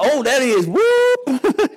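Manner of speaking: high squeaky mock bravado, rising to a whoop; mock eager fighter. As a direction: play the clueless challenger bouncing in. Acting out the clueless challenger.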